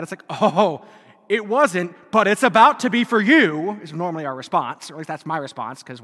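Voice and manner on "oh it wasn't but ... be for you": loud, threatening comeback; mock menacing retort. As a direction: snap back loudly with a playful threat. Mock retort to unwelcome comfort.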